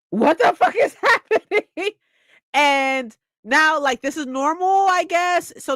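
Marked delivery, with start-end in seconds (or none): 0.1-1.9 high, loud, breaking into laughter on the question; can't hold it together, laugh through the words; laughing disbelief. Retold or imitated. Reader overwhelmed by zombies at school, laughing in disbelief. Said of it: "And I'm like".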